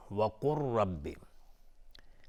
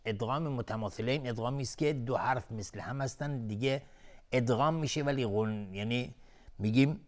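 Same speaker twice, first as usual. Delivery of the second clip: ordinary delivery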